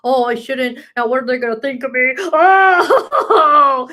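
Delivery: mock worry rising to a laughing wail; mock panic. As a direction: wail it half joking, half anguished. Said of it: "I'll go off and be like".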